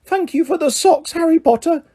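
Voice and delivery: high miserable Dobby voice; miserable call. A narrator's reading. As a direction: call after them, forlorn. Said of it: "Dobby called miserably".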